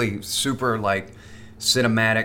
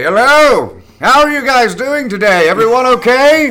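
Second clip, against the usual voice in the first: very loud, high-pitched hammy greeting; Patrick Stewart; 14 semitones higher, 12 dB louder than usual. Acted. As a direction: boom it out theatrically to a whole room. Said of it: "random Patrick Stewart impression".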